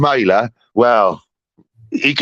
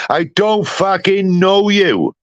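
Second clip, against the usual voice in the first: pitched up and slowed right down; slow, high emphatic dismissal; 8 semitones higher, slower than usual. Real, not acted. Ranting at Smiler, slow and high in disgust. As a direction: drag each word out in high disgust.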